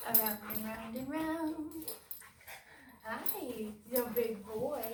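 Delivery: high repeated cooing greetings. Real, not acted. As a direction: coo and fuss over the dog. Greeting the excited dog.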